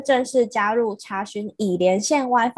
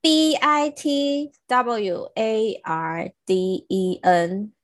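slow, letter by letter spelling; much slower than usual. Shown as a demonstration. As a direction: spell each letter slowly and clearly. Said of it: "补充一下它的英文怎么拼哦".

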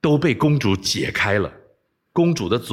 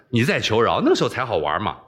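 usual speaking voice

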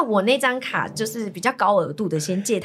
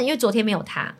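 usual speaking voice

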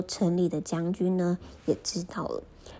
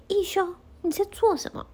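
high, loud shout of Ikkyu's name; shouting elder; 12 semitones higher, 3 dB louder than usual. Acted. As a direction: yell at the boy in shock. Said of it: "长老就大喊说". (高声大喊)

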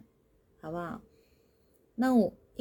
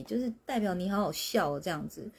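usual speaking voice

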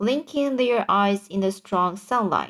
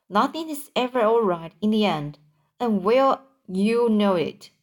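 usual speaking voice

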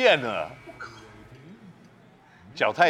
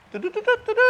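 high sung toots as background music; self-made music; faster than usual. Pretend. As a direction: hum a high little theme tune. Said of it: "自配音乐". (自己配的高音乐声)

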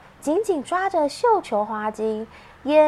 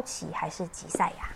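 whispering; 7 semitones lower, 13 dB quieter, faster than usual. Real, not acted. Asks child quietly.

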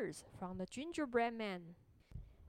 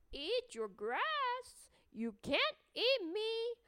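high, slow teasing little voice; gingerbread man voice; 9 semitones higher, slower than usual. Acted. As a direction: taunt the cow sweetly, slowly. Gingerbread man teases cow.

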